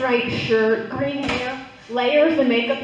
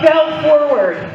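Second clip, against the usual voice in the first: dramatic shout; 3 semitones higher, 8 dB louder, much slower than usual. Acted. Telling the fall.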